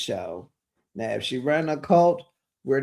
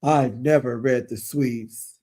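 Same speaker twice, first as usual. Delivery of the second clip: slow, slightly lowered put-on voice; mistakenly slow character voice; Lisa; 3 semitones lower than usual. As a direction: stretch it out in someone else's voice. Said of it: "That's Lisa's voice".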